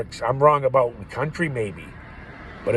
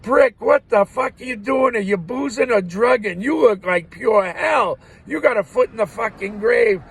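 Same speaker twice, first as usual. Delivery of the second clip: higher, louder teasing tirade; mock insulting yell; 10 semitones higher, 6 dB louder than usual. Weight guesser ribbing a customer at the carnival, mock yelling. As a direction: rib him hard, half yelling.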